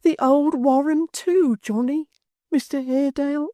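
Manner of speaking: a simple-hearted fellow crying over his ruined friend; tearful lament. A narrator's reading. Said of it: "cried outright and wrung his hands".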